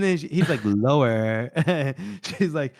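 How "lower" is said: low-pitched curt order; commanding. Acted. Ordering the kneeling man further down, commanding. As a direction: drop the pitch and command him.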